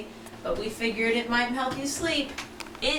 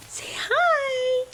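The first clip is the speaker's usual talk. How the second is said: high sing-song coaxing; 11 semitones higher than usual. Real, not acted. Mother coaxing her infant.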